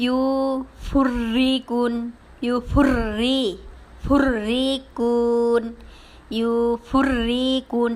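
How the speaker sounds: slow, level ইউফুররিকুন with long vowels; thick-then-thin R. Shown as a demonstration. Teacher shows the word with pesh, slow recited ইউফুররিকুন. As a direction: stretch ইউফুররিকুন, rolling heavy into a light রি. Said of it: "কিভাবে উচ্চারণটা হতো দেখেন".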